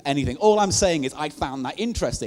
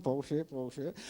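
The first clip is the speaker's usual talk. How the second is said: soft muttered aside, much softer; under-breath aside; 10 dB quieter, slower than usual. Retold or imitated. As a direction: drop to a muttered sceptical aside. Muttered aside during the seance.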